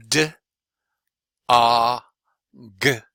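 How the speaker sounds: D, A, G as three separate sounds, "duh, ah, guh"; D, A, G sounded out one by one. Shown as a demonstration. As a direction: stretch out D, A, G with a long gap between each. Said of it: "stretch that one out by each individual sound".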